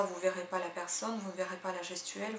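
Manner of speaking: ordinary delivery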